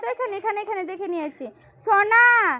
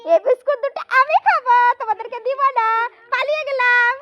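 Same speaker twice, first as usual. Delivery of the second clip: higher, louder sing-song taunt, building as she runs off; playful mock teasing; 5 semitones higher, 6 dB louder than usual. Mother playfully teasing the children over their biscuits, higher and louder mock taunt. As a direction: tease them like you're stealing their biscuits and running away.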